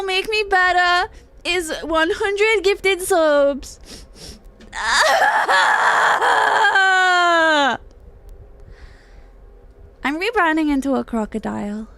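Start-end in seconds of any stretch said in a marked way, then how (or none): 4.7-8.9 loud cackling laugh that cracks into a cry; big shrieking laugh. Real, not acted. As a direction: burst out in a wild laughing shriek. Laughs after begging for subs.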